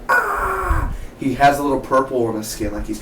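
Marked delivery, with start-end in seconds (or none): 0.1-0.9 high mock scream of pain; high, hammed-up cry of pain; mock yell. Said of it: "when he yells".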